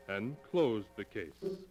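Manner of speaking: normal talking voice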